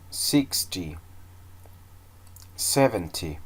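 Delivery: "Twenty", "thirty", "ninety" hit hard on the first syllable, "TWEN-ty", "THIR-ty", "NINE-ty"; modelling count with the first syllable of each number pressed; stress on first syllable. Shown as a demonstration. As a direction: put the weight up front on each number, like a teacher modelling. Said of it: "I put the stress in the first syllable".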